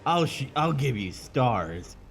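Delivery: loud, slow put-on growl of a line; mock Nemesis line. Recalling the famous line to a friend, slow loud mock menace. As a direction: deliver it like a menacing cheesy villain. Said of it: "He's like".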